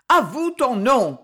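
loud, drawn-out command; yelling. A narrator's reading. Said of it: "hurlait Lureau". (ordre hurlé, appuyé)

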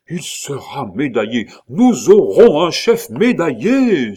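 swept away by joy, shout it out; rapturous cry. A narrator's reading. Claude, thrilled by the medal, cries out in rapture. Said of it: "s'écria Claude avec transport".

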